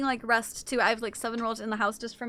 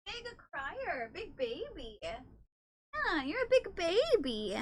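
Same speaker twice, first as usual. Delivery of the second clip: soft, high teasing coo; 4 semitones higher, 8 dB quieter, much slower than usual. Real, not acted. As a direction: tease the cat gently. Cooing to cat.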